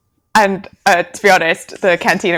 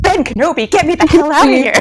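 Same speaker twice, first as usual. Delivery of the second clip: bossy Leia; 8 semitones higher, 5 dB louder than usual. Retold or imitated. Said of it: "And she's like".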